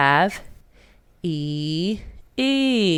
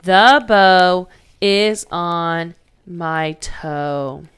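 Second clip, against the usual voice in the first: slow dictation; much slower than usual. Shown as a demonstration. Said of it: "pause the video until you're all done".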